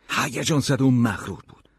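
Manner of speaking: ordinary delivery, nothing marked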